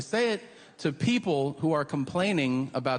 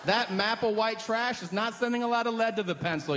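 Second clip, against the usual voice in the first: mock polite; 7 semitones higher than usual. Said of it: "interrupt and be like".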